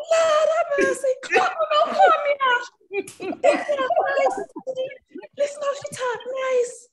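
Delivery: very high, loud patois cries; amazed Jamaican kids. Retold or imitated. Schoolkids marvelling at her accent, shrill delight. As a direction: shriek with delighted wonder. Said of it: "the Jamaican kids were like".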